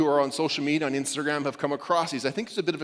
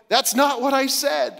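indignant author; 8 semitones higher, 4 dB louder than usual. Retold or imitated. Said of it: "Luke or Paul or Moses going".

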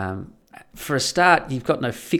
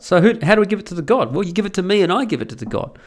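ordinary delivery

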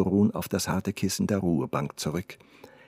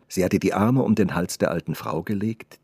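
ordinary delivery

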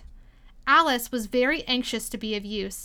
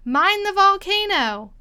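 high, loud, drawn-out warning; calling out; 8 semitones higher, 7 dB louder, slower than usual. A narrator's reading. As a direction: shout a warning, stretching the words.

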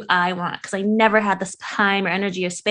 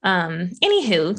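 usual speaking voice

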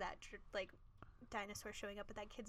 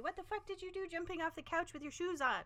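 high-pitched imitation of an angry mom; scolding mom; 8 semitones higher than usual. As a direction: scold like a mother catching the kids. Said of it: "she's gonna be like".

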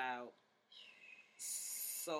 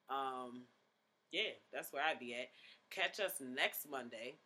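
normal talking voice

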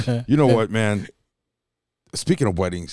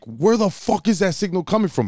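high, baffled retold question; mock bewilderment, pitch raised; dumbfounded; 10 semitones higher than usual. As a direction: replay your total bafflement. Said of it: "we're dumbfounded".

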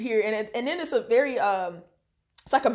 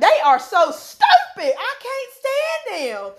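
"stupid" punched loud and slow; emphatic stupid; 11 semitones higher, 5 dB louder, slower than usual. Shown as a demonstration. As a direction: lean hard on "stupid" with a laugh. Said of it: "That's how it comes out".

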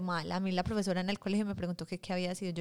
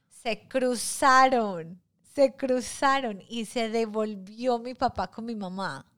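slow and loud, dragging out "Se cruzaron"; dramatic reveal; 3 semitones higher, 8 dB louder, much slower than usual. Real, not acted. Teller reveals her parents crossed paths, slow and loud. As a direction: stretch the words to land the twist.

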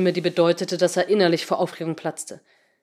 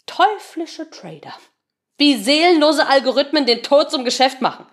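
scornful; 7 semitones higher, 5 dB louder than usual. Acted. Quoting headline with scorn.

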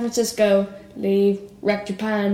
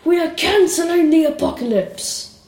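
mock rallying shout, raised pitch; imitating screamed speech; 6 semitones higher, 3 dB louder than usual. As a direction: belt it out like a film hero's battle speech. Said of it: "he screams at the top of his voice".

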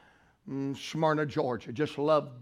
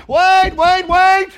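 high, loud urgent holler; yelling; 13 semitones higher, 14 dB louder, much slower than usual. Retold or imitated. Husband to the preacher as the service closes, yelling. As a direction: yell it out to stop the preacher. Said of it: "He's yelling".

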